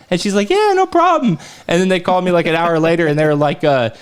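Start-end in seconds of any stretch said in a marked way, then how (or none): 0.5-1.4 high, bright-sounding landlord reply; a higher mock landlord voice; cheery landlord voice. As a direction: pitch it up, breezy and obliging.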